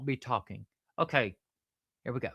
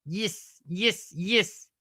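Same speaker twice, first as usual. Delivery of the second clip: higher, short Australian-accent repetitions; Australian accent practice; 5 semitones higher, much slower than usual. Shown as a demonstration. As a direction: drill the word in would-be Australian. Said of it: "It's more of a yes".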